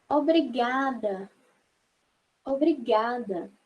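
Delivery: slow, deliberate obrigada; slow thanks. Shown as a demonstration. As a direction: say it slowly like tipping a hat. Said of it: "Como se estivesse tirando o chapéu". (obrigada devagar)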